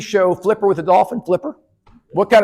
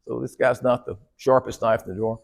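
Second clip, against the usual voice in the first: normal talking voice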